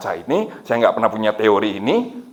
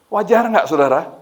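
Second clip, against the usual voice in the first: normal talking voice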